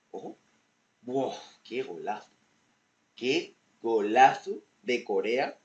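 excited. Real, not acted. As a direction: savour the goal loudly. Goal scored in highlights.

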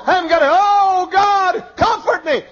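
high, loud shouted plea to God; anguished prayer shout; desperate cry. Acted. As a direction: cry out for comfort at full force. Preacher acts desperate plea.

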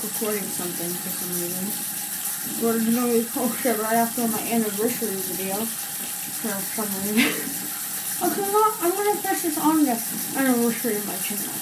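talking while brushing teeth; toothbrush in mouth. Real, not acted. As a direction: mouth full of toothpaste foam. Seen 2: Toothbrush visibly in her mouth, brushing, from 0.6s onward.

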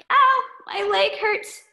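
slow, high cartoon kid ow; a clumsy kid yelping at a hurt leg; high kid voice in pain; high, slow kid whine. Klutzy Boy voiced in story.

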